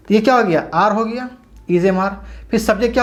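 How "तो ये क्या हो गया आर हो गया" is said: ordinary talk, nothing marked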